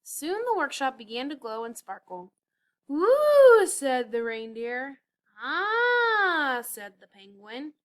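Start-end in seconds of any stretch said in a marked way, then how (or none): 2.9-3.7 loud high whoop; cheer. A narrator's reading. Reindeer cheering at the glowing workshop, loud whoop. 5.4-6.6 loud high cry of wonder; gasp of awe. A narrator's reading. Penguin marvelling at the lights, high cry.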